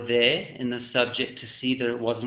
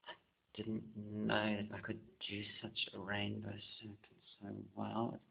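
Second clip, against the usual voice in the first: hushed; 2 semitones lower, 15 dB quieter than usual. Real, not acted. Self-praise while painting.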